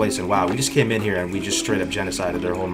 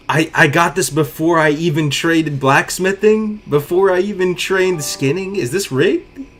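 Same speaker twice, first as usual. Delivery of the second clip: incredulous; 8 semitones higher, 5 dB louder, slower than usual. Real, not acted. Lucky loot disbelief.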